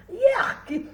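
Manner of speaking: high, loud yuck; gagging-style exclamation of disgust. A narrator's reading. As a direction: blurt out sharp revulsion. Boy chewing a hairy raw ear.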